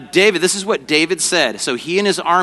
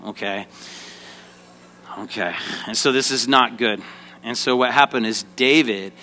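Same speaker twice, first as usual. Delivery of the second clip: ordinary delivery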